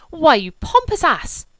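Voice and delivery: loud, slow, high furious cry; angry outburst. A narrator's reading. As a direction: explode at him in indignation. Julia bursts out at her brother.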